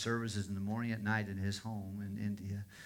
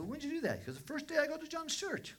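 high-pitched, louder quoted exclamation; excited retelling; 13 semitones higher, 4 dB louder than usual. Retold or imitated. Pastor retelling a convert's first church day, excited. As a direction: imitate an eager convert, pitched well up.

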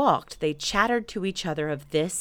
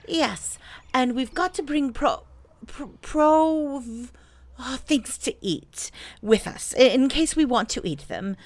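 higher, halting bear voice; muddled Pooh; 7 semitones higher, 3 dB louder than usual. Acted. Pooh tries to say provisions to Rabbit, high and muddled. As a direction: stumble over a long word, then give up.

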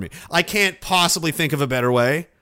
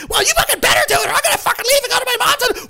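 very high, loud screeching threat; wife screaming she'll go to her mom's; shrieking wife threat; 5 dB louder, much faster than usual. Retold or imitated. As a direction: screech a threat to leave him. Acted couple fight, the wife.

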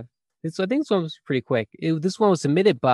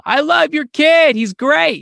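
slow, high, loud praise; yelling; 12 semitones higher, 7 dB louder, slower than usual. Acted. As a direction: cheer it out like a parent at a game. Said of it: "you could yell at a child".

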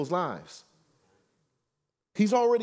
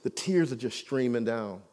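ordinary delivery

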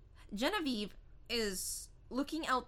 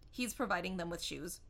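normal talking voice

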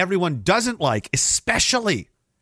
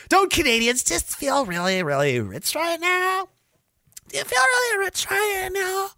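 much higher, drawn-out put-on voice; mock strained squeal. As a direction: squeeze the question out high and slow. Sarcastic put-on question to everyone.

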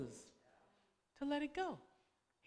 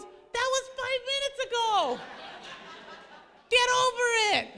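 much higher, louder, laughing dismissal; husband brushing it off; 11 semitones higher, 7 dB louder than usual. Retold or imitated. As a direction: play the husband telling her to move on. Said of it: "And he goes".

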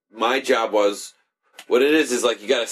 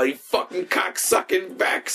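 mock cursing; 9 semitones higher than usual. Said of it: "call things cocksuckers".

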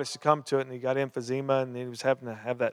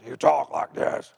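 pirate voice; 2 semitones lower, 5 dB louder, slower than usual. Retold or imitated. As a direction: growl it like an old sailor. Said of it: "he sounded like a pirate".

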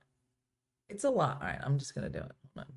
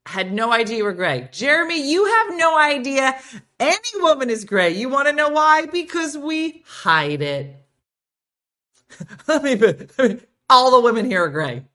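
loud, higher, laughing tease; loud teasing rant; 7 semitones higher, 10 dB louder than usual. Real, not acted. Host loudly teasing Jeremy that every woman hides her gray. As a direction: call Jeremy out with a cackle.

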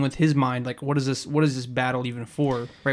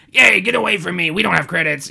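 mock gruff yell; 4 semitones higher, 5 dB louder than usual. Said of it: "He's saying".

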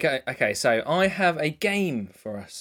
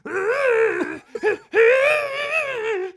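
dinosaur cry. Pretend. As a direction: wail like a dinosaur. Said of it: "As a dinosaur".

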